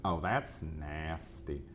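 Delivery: slow, soft catchphrase in a put-on cartoon voice; drawled cartoon-dad catchphrase, quiet; Cleveland impression. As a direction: stretch it out lazily, lightly imitated. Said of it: "or when he goes".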